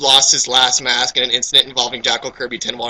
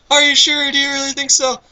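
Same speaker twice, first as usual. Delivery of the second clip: voiced dialogue; 10 semitones higher than usual. Rereading the dialogue in voice.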